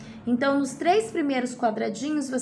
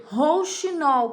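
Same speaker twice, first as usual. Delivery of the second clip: rouxinol drawn out syllable by syllable; slow, clear word; 4 semitones higher, 3 dB louder, much slower than usual. Shown as a demonstration. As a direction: stretch rouxinol for the kids. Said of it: "teacher says example word slowly".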